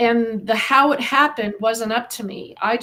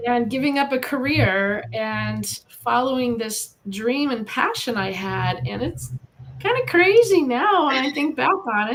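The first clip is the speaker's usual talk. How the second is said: ordinary delivery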